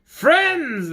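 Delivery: high eager cry of friends; exclaimed. Acted. Visitors answering the girl at once, high exclaim. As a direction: answer all together, bright and high. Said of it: "they all exclaimed".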